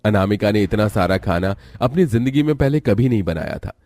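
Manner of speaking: plain reading voice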